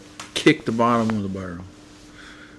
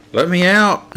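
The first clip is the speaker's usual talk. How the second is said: shout; 10 semitones higher, 12 dB louder, slower than usual. Acted. Trapped kid calling from barrel.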